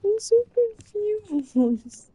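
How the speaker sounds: pleading confusion over the compliment; baffled. Real, not acted. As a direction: stretch it out in disbelief. Protesting partner calls her cute.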